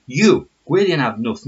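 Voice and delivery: YOU and Gwydion ab Nuth said loud and firm; stern teacher calling out the name; scolding full name. Shown as a demonstration. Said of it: "No, sorry".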